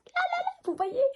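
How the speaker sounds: squealing in alarm, very high. Real, not acted. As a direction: shriek it as you point at the smudge. Shows mascara smudge, dismayed.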